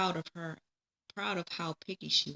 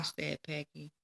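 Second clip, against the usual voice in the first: ordinary delivery, nothing marked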